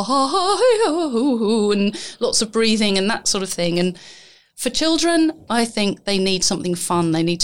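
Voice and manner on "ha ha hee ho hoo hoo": warm-up vocal sirens. Shown as a demonstration. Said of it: "they've done sort of".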